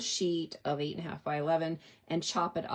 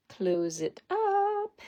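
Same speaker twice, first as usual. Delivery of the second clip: much higher, slow, playful sing-song; 14 semitones higher, much slower than usual. Pretend. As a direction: stretch it out high and playful. Sing-song repeat of her instruction.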